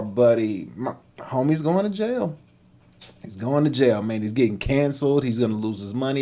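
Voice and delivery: ordinary talk, nothing marked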